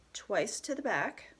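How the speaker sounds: ordinary talk, nothing marked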